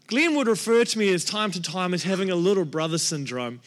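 normal talking voice

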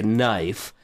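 ordinary talk

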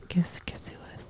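teasing stage whisper. Pretend. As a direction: whisper it like a secret. Teasing whispered guessing game.

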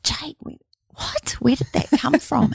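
a whispered, high, astonished question; amazed. Retold or imitated. Mother stunned by her ten-year-old son's wisdom, amazed. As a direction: gasp it out in disbelief. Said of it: "amazed at son".

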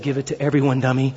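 soft, quiet, loving teasing; a tender rebuke from God, softly spoken; lovingly. Retold or imitated. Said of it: "God just very lovingly said".

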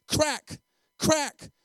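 loud, high voiced whip crack; belt crack. A narrator's reading. Preacher narrates the beating, loud sharp crack.

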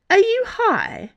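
very high, drawn-out challenge; incredulous demand. A narrator's reading. Bea challenging Dana's wild claim, incredulous demand. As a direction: demand it, voice leaping way up. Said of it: "Bea demanded".

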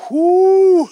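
high, loud whoo; whoo exclamation. Real, not acted. Preacher whoops at the congregation, high and loud.

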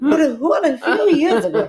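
high, loud laughing Mrs. Doubtfire imitation. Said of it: "it sounded like, um, uh, Missus Doubtfire".

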